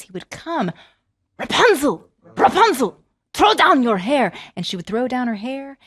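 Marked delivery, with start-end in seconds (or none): 1.4-4.3 calling up. Acted. Prince calls.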